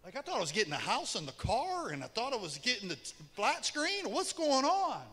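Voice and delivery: quiet, fast aggrieved protest from the sons; sons' mock complaint about the lost inheritance; whiny sons protesting. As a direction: rattle it off softly like a stunned kid. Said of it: "they're like".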